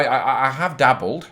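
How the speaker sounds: ordinary talk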